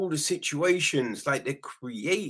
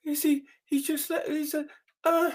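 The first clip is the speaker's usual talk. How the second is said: stammering shock; 11 semitones higher than usual. Retold or imitated. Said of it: "open the door and be like".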